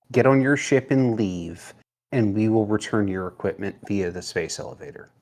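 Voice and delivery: normal talking voice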